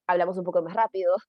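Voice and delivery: rattled off quickly; fast speech demo. Shown as a demonstration. Teacher showing class fast talk, quick pace. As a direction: speed up to show rápido. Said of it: "como ahorita".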